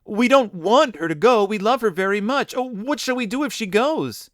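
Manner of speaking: despairing. Acted. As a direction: plead in rising despair. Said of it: "they cried in despair".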